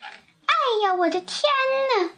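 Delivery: put-on high-pitched flustered old woman; acted coy surprise at an honour; bashful old woman. A narrator's reading. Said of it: "瘦小的老妇人不好意思地说".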